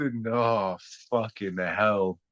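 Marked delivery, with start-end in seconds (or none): none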